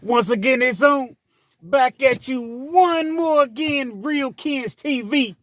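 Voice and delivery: high, drawn-out announcer opening; hyped show intro. Real, not acted. Greeting viewers back to the channel, hyped announcer intro.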